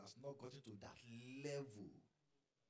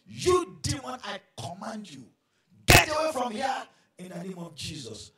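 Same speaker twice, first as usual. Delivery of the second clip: loud, high commanding shout; holy anger shout; 10 semitones higher, 23 dB louder than usual. Acted. Man of God rebuking the demon with a loud high shout. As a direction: cast the demon out with force. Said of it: "with holy anger, he shouted".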